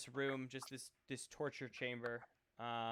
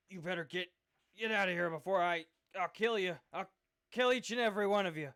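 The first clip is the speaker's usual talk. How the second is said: loud, high angry threat; threatening; 4 semitones higher, 9 dB louder, faster than usual. Acted. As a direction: threaten to kill them all. Captive threatens the party.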